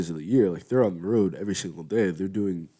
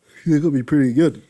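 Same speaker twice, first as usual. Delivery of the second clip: excited; 7 semitones higher, 9 dB louder than usual. Real, not acted. Hyped excited remark.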